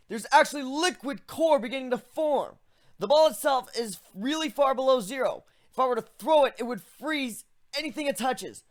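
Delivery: put-on a young griffin walking a friend through his magic; griffin hero voice. Griffin explains the ball of air.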